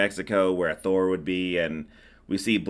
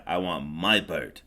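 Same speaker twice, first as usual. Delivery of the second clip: put-on Mickey Rourke Russian accent; 5 semitones lower, 4 dB quieter than usual. Joking to cohost, a lower mock Russian accent. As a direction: imitate the villain's thick Russian accent, lower. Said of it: "my Russian accent is just as good as Mickey Rourke's Russian accent".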